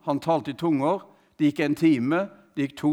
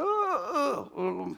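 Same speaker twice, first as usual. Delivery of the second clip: failing voice; 9 semitones higher, 6 dB quieter than usual. Retold or imitated. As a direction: imitate a voice giving out, high and soft. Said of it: "stemmen begynte å svikte".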